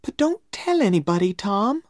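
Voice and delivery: put-on high, quick girlish urging; secretive girl. A narrator's reading. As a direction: urge him quickly to keep it secret. Becky asks for secrecy.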